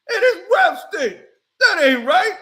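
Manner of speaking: fuming parent complaining loudly; outraged dad. Acted. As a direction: shout it like an angry father. Dad shouts the insult.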